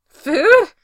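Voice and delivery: the voice jumps up in alarm; voice rising in pitch. A narrator's reading. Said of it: "voice rising worryingly in pitch".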